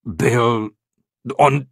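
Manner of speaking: flustered bandit stutter; loud, tongue-tied stammer. A narrator's reading. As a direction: grope for words. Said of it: "koktal Barton".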